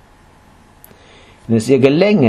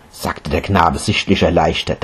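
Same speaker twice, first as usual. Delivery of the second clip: ordinary delivery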